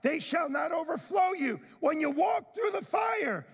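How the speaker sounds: shouting the verse, high and building; preaching the scripture at full voice, carried away; excited reading. Real, not acted. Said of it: "I'm getting excited with the verse".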